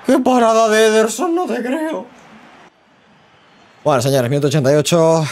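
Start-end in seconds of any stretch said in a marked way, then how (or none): 0.1-2.1 very high, loud cry of astonishment; high-pitched outburst, easing off in volume; real disbelieving cry. Real, not acted. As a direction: exclaim at an unbelievable save. Reacting live to a big save.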